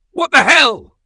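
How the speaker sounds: very high, very loud outburst; angry shout. A narrator's reading. Father to kids just home after dark, angry shout. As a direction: explode in a shout of anger and fear. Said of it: "their father shouted".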